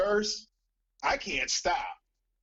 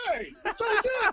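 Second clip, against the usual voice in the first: sheepish high self-quote. Retold or imitated. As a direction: squeak it out like a guilty kid. Repeats goofy self-quote on request.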